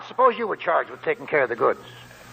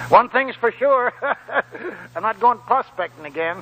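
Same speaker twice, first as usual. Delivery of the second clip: laughing; 7 semitones higher than usual. Acted. Old man laughing about future.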